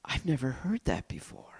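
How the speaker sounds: hushed wonder, breathed out; whispered thought. Retold or imitated. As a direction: whisper it to yourself in awe. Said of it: "And I thought".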